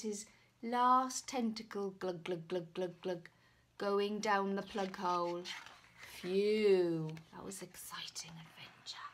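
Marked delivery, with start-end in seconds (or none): none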